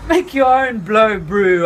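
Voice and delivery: loud, high laughing comeback; laughing retort. A narrator's reading. The narrator laughs off Voodoo's request, loud. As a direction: toss it back with a laugh.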